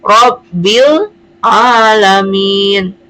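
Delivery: very slow "Robbil Alamin", the l of Robbil clearly pronounced; clearly sounded lam. Shown as a demonstration. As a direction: recite "Robbil Alamin" in drawn-out syllables with a distinct lam. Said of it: "Makanya membacanya jadi".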